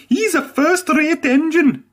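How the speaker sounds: very high, bright cheering voice; cheerful Douglas. A narrator's reading. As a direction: praise a friend with full-hearted joy. Douglas praising Edward.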